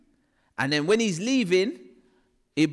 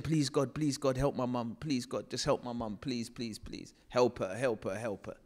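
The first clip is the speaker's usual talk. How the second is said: soft, fading plea to God; a child praying under his breath; whispered desperate prayer, growing fainter; hushed pleading prayer; 6 semitones lower, 7 dB quieter than usual. Retold or imitated. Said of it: "I'm praying, I'm saying".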